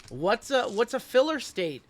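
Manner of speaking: can't believe what chat just said; indignant disbelief. Real, not acted. Indignant at chat's comment.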